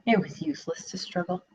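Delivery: normal talking voice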